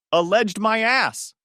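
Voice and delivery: loud, slow yell; yelling back. Acted. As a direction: shout it back across the house. Billy yells back.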